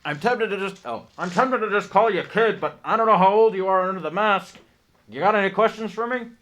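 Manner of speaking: loud, high character voice; booming train conductor. Acted.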